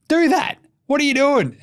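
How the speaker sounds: high, loud frustrated shout; exasperated yell. Real, not acted. Fan yells at Miami's defense, loud exasperated shout. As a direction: yell it at the bench in disbelief. Yelling advice at coach.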